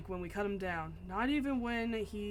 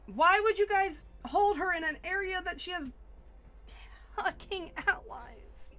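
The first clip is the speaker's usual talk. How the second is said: louder, higher disbelieving rant; exasperated rant; 7 semitones higher, 3 dB louder than usual. Real, not acted. Player ranting at the game's guards, exasperated. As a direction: vent at the dumb guards. Angry rant at NPC plot.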